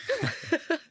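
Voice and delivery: high, flustered burst of laughter; embarrassed laugh. Real, not acted. Scene: can't remember her father's region, embarrassed laugh. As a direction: laugh at her own blank memory.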